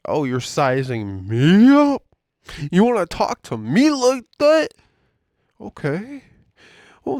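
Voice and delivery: mock taunting boss. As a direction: needle him like a petty boss. Imitating bosses taunting him.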